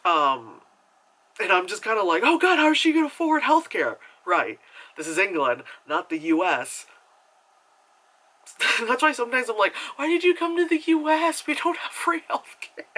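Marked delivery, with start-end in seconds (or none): 2.2-4.0 high, loud fretting; worried outburst. Retold or imitated. Viewer worrying over the lady's doctor visit, mock panic. 10.0-12.9 high-pitched mock wail; exasperated plea. American asking newcomers about healthcare, high exasperated plea.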